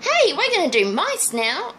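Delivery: cheerful announcement. Retold or imitated. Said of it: "just say".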